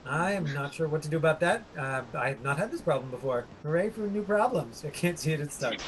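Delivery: ordinary delivery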